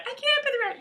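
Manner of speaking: very high-pitched mock damsel; squeaky, pleading damsel in distress; melodrama damsel. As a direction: play a helpless heroine up in falsetto. Melodrama villain and damsel skit.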